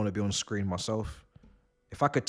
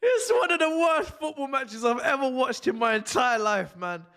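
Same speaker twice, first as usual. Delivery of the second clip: hysterical high-pitched wheezing laugh; helpless, tearful cackling; crying with laughter. Real, not acted. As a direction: force words out between shrieks of laughter. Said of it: "I'm actually crying".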